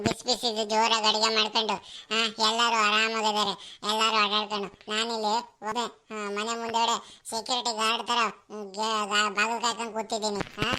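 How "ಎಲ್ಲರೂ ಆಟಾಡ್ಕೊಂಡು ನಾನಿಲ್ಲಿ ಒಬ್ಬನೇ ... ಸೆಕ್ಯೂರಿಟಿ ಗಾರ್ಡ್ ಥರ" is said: usual speaking voice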